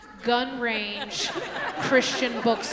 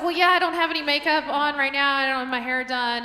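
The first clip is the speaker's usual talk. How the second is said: defensive protest; 6 semitones higher, 9 dB louder than usual. Retold or imitated. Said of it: "I was like".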